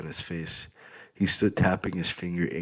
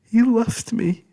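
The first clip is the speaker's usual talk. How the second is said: high, slow sobbing wail; crying; 8 dB louder, slower than usual. Acted. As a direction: let it break out of you in tears. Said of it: "crying harder uncontrollably".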